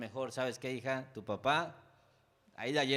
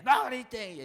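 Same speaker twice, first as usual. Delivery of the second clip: hammed-up yell, much higher and louder; comic shout; 10 semitones higher, 10 dB louder than usual. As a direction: yell it like a comedy dad. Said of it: "si hicieran una película mía, sería como de comedia". (grito cómico de papá, muy agudo y fuerte)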